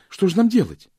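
very high, loud helpless appeal; pleading. A narrator's reading. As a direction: beg for an answer in a high cry. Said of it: "взмолилась Джейн". (умоляюще, очень высоко)

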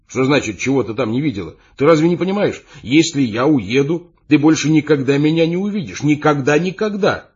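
indignant, building to loud high cries; agitated protest. A narrator's reading. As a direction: plead with rising exasperation. He protests her indifference, rising agitation. (возмущённо, с нарастающим отчаянием)

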